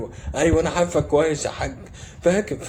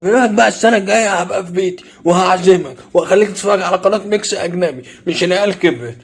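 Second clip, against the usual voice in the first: annoyed outburst; 6 semitones higher, 5 dB louder than usual. Acted. Acted argument over what to watch.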